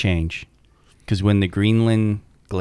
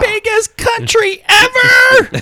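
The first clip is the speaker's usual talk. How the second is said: excited; 7 dB louder, slower than usual. Pretend. Grandiose exclamation about Greenland.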